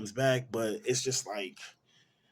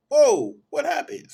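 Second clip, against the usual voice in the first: much higher and louder, slowed shock; startled whoa; 12 dB louder than usual. Pretend. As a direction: jump at the brick crashing through. Friend hit by a brick.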